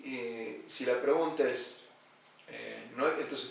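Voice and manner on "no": ordinary talk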